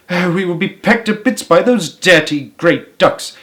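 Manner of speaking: mild fearful cry; a worried wife crying out; mildly alarmed wife's cry; cried out. A narrator's reading. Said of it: "cried Missus Gregg".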